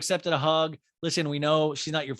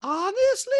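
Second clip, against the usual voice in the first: loud, high, slow mimicry; Diana; 7 dB louder than usual. Diana questioning Sutton, high mock impression. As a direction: imitate her questioning way up high. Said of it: "And Diana's like".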